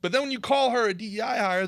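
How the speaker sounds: usual speaking voice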